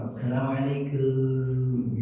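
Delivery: slow, soft, loving salam; soft tender greeting. Shown as a demonstration. Husband greeting his wife at home, slow and tender. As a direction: draw out the greeting gently like a love word. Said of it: "Kalau jenengan lembut enggak kalau salam?".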